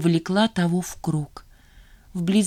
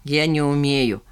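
lower, pompous announcement; lofty, self-important refusal; 3 semitones lower than usual. A narrator's reading. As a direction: inform them with a weighty, important air. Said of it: "важно сообщил тот".

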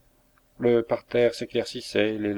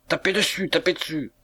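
high, excited command; urgent egging-on; 6 semitones higher than usual. A narrator's reading. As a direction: urge them on in a pitched-up voice. Sergeant urges men to hit.

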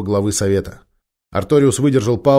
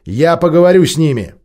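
loud declaration; 8 semitones higher, 7 dB louder than usual. Acted. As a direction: declare it boldly. Leader declares he flies first.

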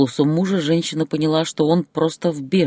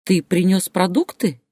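higher, hopeful question; 6 semitones higher than usual. A narrator's reading. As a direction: ask with timid hope. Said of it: "с надеждой спросила она". (с надеждой)

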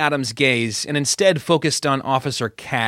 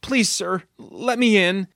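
higher, softened plea; begging; 7 semitones higher than usual. A narrator's reading. Girl in handcuffs begs officer to let her in, pleading. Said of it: "Judith begged".

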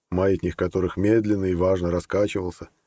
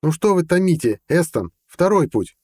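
raised, louder impatient demand; losing patience; 10 semitones higher, 5 dB louder than usual. A narrator's reading. Zaid cuts into the judge's dramatic pause, impatient. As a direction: press him, unable to wait any longer. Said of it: "Зайд не выдержал".

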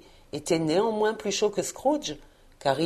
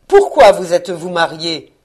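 loud, falling counter-question; stern retort; 9 dB louder than usual. A narrator's reading. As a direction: throw the question back hard. Scrooge rétorque sèchement. (réplique sévère et forte)

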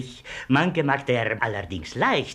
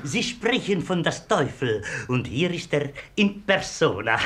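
ordinary delivery

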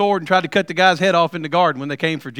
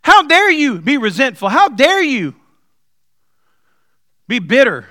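higher, louder rebuke; God rebuking; 6 semitones higher, 4 dB louder, slower than usual. Retold or imitated. God rebuking the preacher's resentment, higher and louder. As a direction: press every how dare you. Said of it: "He said".

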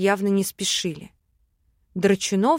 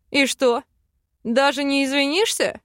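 scoffing; 5 semitones higher than usual. A narrator's reading. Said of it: "фыркнул дракон".

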